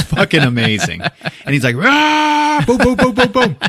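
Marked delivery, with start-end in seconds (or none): 1.8-2.6 high, loud mock battle roar; imitated battle cry. Storyteller imitates man shooting everybody, battle roar. 2.6-3.6 loud, high rapid-fire booms; imitated gunshots. Storyteller imitates revolver shooting up the room, booming gunshots.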